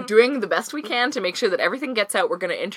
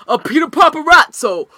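character voice; 9 semitones higher, 7 dB louder than usual. Retold or imitated. Acting out a show character.

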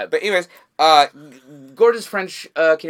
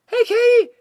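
nervous squeak, pitched way up; trying to sound cool, but the voice leaps up; nervous fan greeting a comedian, voice shooting high; voice jumping up high; 8 dB louder than usual. Retold or imitated. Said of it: "my voice is going up three octaves".

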